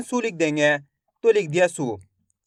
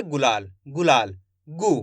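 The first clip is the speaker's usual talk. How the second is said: गुलाल drawn out slowly and lower, twice; slow careful demo; 8 semitones lower, 3 dB quieter, slower than usual. Shown as a demonstration. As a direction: say गुलाल slowly so each sound is heard. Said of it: "शब्द का उच्चारण कैसे हो रहा है?". (धीरे-धीरे साफ़ उच्चारण करके दिखाओ)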